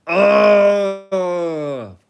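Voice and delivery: loud, high wailing cry of despair; a sudden loud cry of dread; anguished cry. Shown as a demonstration. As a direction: let out a big anguished howl. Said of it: "I wanted to make a sound that sounded something like this".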